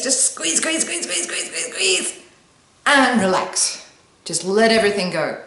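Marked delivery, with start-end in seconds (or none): 0.0-2.2 high, strained and laughing; straining squeeze. Shown as a demonstration. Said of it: "So do it with me". 2.9-3.9 slow, drawn-out relax; release. Shown as a demonstration. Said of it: "So do it with me".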